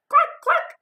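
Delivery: high, loud clucking; high hen clucks; chicken cluck. Acted. Chicken answers Duck.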